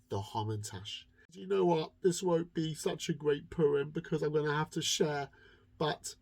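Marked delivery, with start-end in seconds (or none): none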